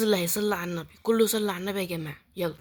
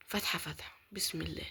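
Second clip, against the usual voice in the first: softer whispered prayer before opening; tense whisper; 3 semitones lower, 7 dB quieter than usual. Real, not acted. Gamer opening a pack nervously, whispered. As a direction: hush it with nerves.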